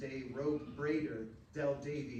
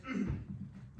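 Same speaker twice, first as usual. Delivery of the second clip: a pointed little throat clear; 8 semitones higher than usual. Acted. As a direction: clear the throat before the polite insult. Del pauses before a jab.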